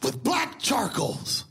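slow emphatic. Retold or imitated. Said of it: "She said".

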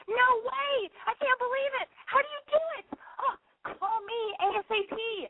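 high, gushing mock teen girl; breathless high girly pitch, hammed up. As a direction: squeal it like a giddy teen ad.

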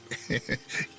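short, hushed schoolboy snicker; soft chuckle. A narrator's reading. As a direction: chuckle quietly under the breath. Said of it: "They both chuckled".

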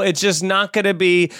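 ordinary talk

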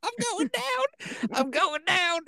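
high slow squeal, mock faint; squeaky fainting voice. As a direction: squeal like you're swooning. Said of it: "she was like".

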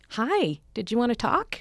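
high, drawn-out prompting hello; slow, high-pitched "can I help you" voice; prompting greeting. Retold or imitated. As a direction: stretch it out to coax a hovering fan. Prompting a hovering fan after show.

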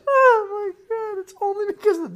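high wheezing laughter; laughing so hard it squeaks; cry-laughing. Real, not acted. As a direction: gasp it out through tears of laughter.

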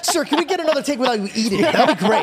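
high director voice, laughing through. Acted. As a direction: tease as a polite director.

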